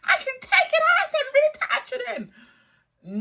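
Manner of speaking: very high, loud mocking squeal with laughter; mocking imitation. Mocking the coworker's bragging about her hair, squealing. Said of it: "It's like".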